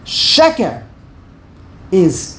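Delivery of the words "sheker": Sheker high and stressed on the first syllable. Shown as a demonstration. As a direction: punch SHE in Sheker. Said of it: "there are two segels".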